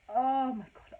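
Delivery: mock-horrified exclamation; retold shocked gasp of apology. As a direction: blurt it out, horrified at what you've done. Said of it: "and I just said".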